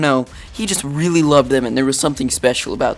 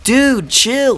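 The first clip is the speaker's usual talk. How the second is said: high-pitched, drawn-out appeal to calm down; retold plea, pitched well up; mock pleading; 9 semitones higher than usual. Retells telling friend to calm down.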